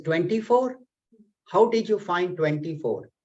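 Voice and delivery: high rising disbelief on "Twenty-four?"; astonished king. Acted. King amazed at the ascetic's twenty-four gurus. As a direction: jump up in amazement.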